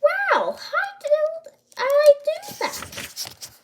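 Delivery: very high, loud, drawn-out amazement; astonished little-girl wonder; amazed disbelief. A narrator's reading. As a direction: squeal it slowly in astonishment. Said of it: "looked at her wand in disbelief".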